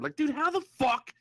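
high, disbelieving rant; can't believe anyone gets fired there; incredulous. Real, not acted. Ranting in disbelief about Bobby's Walmart firing.

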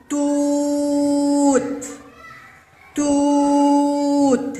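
"توت" with ū held very long on a high level note; strong long damma. Shown as a demonstration. Teacher has children listen for damma, drawn-out monotone. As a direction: intone tūūūt, stretched out. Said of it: "هل تستمعون لصوت الضمة القوية".

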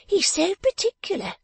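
very high, fussing lady's voice; fussy wife. A narrator's reading. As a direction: fret about the rich uncle. Wife fusses about uncle.

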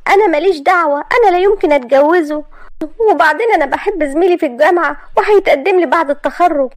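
put-on high, loud young woman's protest; girl crying out against the marriage; indignant young woman. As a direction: refuse her mother in shock. Maria keeps refusing.

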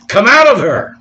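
loud, high commanding cry; ringing pulpit shout; voice from heaven. Acted. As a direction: call it out as heaven's urgent command. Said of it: "another voice from heaven saying".

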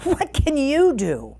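storybook king's scornful question; spat with scorn. A narrator's reading. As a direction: challenge her, stretching the question. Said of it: "The king spat at her".

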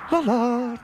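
high, airy trill. Acted. Unruffled aunt as rifle shots come closer, trilling. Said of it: "trilled Florrie".

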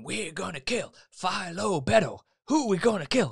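loud, high mock biker boss; hammed-up gang leader whipping up his men; biker leader rallying. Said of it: "he's standing there going".

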